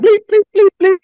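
loud, very high bleeps; string of high mock beeps; imitated scanner beeps. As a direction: mimic a machine in short piercing beeps.

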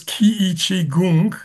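Kiichi Gung said as kee-chee goong; name pronunciation demo. Shown as a demonstration. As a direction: pronounce Kiichi Gung with a long ee and a short oo in Gung. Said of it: "will you pronounce and explain".

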